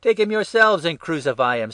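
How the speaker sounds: high, forceful comeback; loud retort. A narrator's reading. Pilate retorting to the chief priests, loudly. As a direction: throw it back at the shouters.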